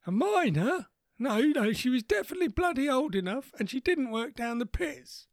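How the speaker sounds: put-on high, quavering old man voice; lightly voiced grandpa, confused and cranky; doddery old grandad. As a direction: play a confused old war veteran.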